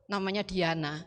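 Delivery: ordinary delivery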